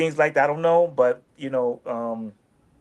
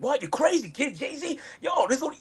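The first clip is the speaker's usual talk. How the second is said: high, incredulous mock outburst; a pitched-up, stunned retelling; retold disbelief; 11 semitones higher, 4 dB quieter than usual. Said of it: "I was like".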